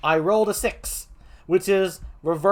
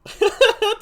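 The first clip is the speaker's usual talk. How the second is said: laugh; 4 dB louder than usual. Real, not acted. Game host laughs at chat.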